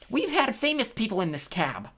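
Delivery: put-on high-pitched old sister exclaiming; excited exclamation. A narrator's reading. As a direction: exclaim it proudly to the passengers, pitched well up. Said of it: "Anger exclaimed".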